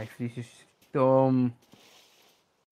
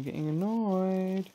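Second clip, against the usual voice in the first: building from annoyed to really annoyed, higher and slow; getting annoyed; 7 semitones higher, slower than usual. Real, not acted. Gamer stuck in a game, annoyance building higher and slower. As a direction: let the annoyance climb word by word. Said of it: "I'm really fucking annoyed right now".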